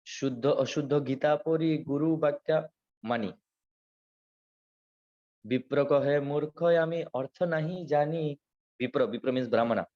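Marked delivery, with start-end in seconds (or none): 0.1-8.4 verse recitation. Acted. Preacher quoting Chaitanya's verse to devotees, chanted.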